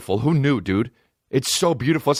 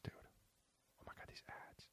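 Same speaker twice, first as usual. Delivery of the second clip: whisper; 38 dB quieter, faster than usual. Real, not acted. Annoyed by ads on the site.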